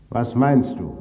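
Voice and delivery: high, put-on robber voice; robber's voice. Robber asks the bound husband, in a high put-on voice. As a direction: ask coolly in another man's voice. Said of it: "fragte er mich".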